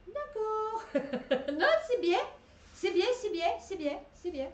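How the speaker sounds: mock approval. Real, not acted.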